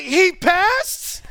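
high, amused "he passed?"; mock shock through a laugh; laughing disbelief. To his wife about the son passing, laughing disbelief.

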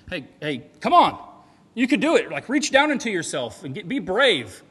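loud, high cheerleading coach; mock pep talk. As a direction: hype them up like a pushy coach. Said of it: "that's not what he's saying".

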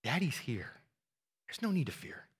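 soft, gentle reassurance; comforting father. Pretend. Father comforting child after nightmare, soft and tender. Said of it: "You say".

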